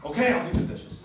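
mock annoyed husband. Shown as a demonstration. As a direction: agree to a chore with irritation. Demo of a wrong tone.

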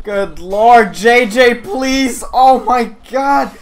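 high, loud protest; exasperated outcry. Real, not acted. Gamer begging for a nerf, loud exasperated protest. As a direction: wail at an unfair opponent. Protesting opponent is too fast.

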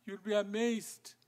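normal talking voice

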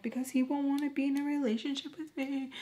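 high, hurried imitated wail; crying. Daughter explaining why she cries, tearful. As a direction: wail it quickly like an upset teen. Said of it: "She asks me why I'm crying".

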